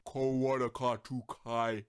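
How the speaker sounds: deep, slow, dying toy voice; low battery voice. Retold or imitated. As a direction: drag it out like a battery running flat. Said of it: "in a very deep, low battery voice".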